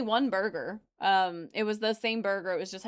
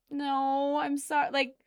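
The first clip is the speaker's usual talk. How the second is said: usual speaking voice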